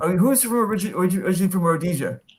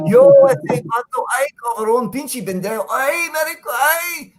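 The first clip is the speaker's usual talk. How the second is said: exaggerated Mexican Spanish, higher-pitched cries of "ay"; Mexican accent; 7 semitones higher, slower than usual. Shown as a demonstration. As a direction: wail it like a Mexican complaining. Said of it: "you gotta do the Mexican".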